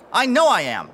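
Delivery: usual speaking voice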